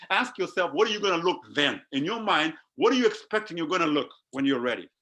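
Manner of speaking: ordinary delivery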